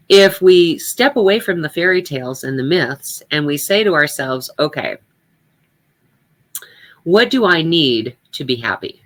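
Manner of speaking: ordinary talk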